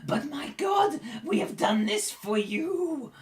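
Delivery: high, slow goblin wail; pleading goblin. Acted. Goblin holding the prisoner pleads with his god, wailing. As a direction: cry up to a god in a squeaky voice. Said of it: "he holds the prisoner right at the edge".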